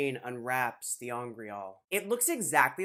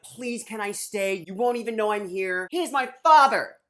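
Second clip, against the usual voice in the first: pleading, building to a shout; 5 semitones higher, 7 dB louder than usual. Retold or imitated. Son begs to stay by father.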